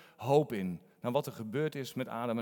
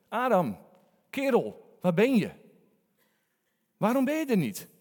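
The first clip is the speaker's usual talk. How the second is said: raised, yearning call of a friend; longing call; 8 semitones higher, 6 dB louder than usual. Shown as a demonstration. Preacher shows God calling with longing. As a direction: call out like missing a dear friend. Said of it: "niet een roepstem van verwijt".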